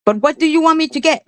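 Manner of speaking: high, fast mocking accent imitation; imitating Tiffany. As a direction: mimic how she talks down to people. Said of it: "she would talk like that".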